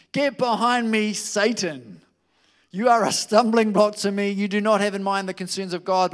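stern rebuke. A narrator's reading. Jesus rebukes Peter.

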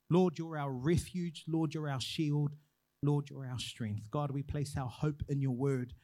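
slow, measured prayer; earnest slow prayer. Acted. Opening prayer litany.

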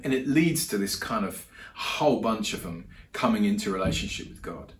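ordinary talk

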